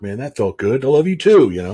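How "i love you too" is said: louder, raised, beaming answer; heartfelt reply. Retold or imitated. Dad answering his daughter, louder and lifted. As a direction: say it back with a swell of joy.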